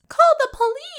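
high squealing call for help; hammed-up alarm cry; mock shout.

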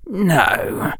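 high, cold, hissed refusal. Acted. Harry answering Bellatrix on automatic as Dark Lord, hissing. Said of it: "hissed Harry's voice".

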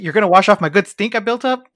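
high, loud mock protest; indignant dog. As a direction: play a dog outraged at bath time. Acting out dog protesting a bath.

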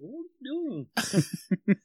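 squeaky startled question; shocked high yelp. Retold or imitated. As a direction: shriek it in surprise. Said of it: "He goes".

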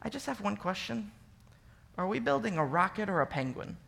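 a hint of a sheepish child; sheepish little boy. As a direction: ask it shyly, barely daring. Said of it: "raises his hand very sheepishly".

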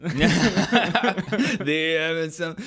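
laughing through the name; slow and laughing, amused at the correction. Real, not acted. Correcting himself while laughing at the mix-up.

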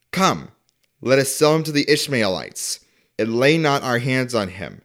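ordinary delivery

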